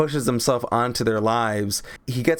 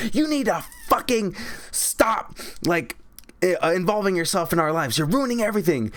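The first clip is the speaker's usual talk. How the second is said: higher mock scolding voice; hammed-up outburst, pitched up; lashing out; 6 semitones higher than usual. Zac Efron lashing out at Ricky, high mock scold.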